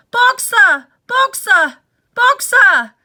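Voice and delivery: loud high desperate call; crying out. Acted. Clover chasing the van, desperate loud cry. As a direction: call after the moving van. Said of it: "she cried".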